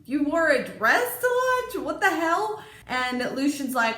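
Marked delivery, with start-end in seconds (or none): 0.0-2.6 high, loud mock disbelief; playing the baffled Tamlin, hammed-up; loud incredulous shout at the dress; outraged Tamlin. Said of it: "Tamlin's like".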